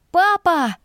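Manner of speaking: put-on high, ringing shout of a boy; child's call. A narrator's reading. Boy calling his father, high ringing shout. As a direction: yell for dad across the road.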